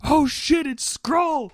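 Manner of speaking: high-pitched imitation of a heckler; mock shout. Retelling someone's outburst at the Avengers screening, mock shout. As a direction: call it out like an overexcited moviegoer. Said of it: "someone shouted out".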